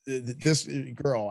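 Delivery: ordinary talk, nothing marked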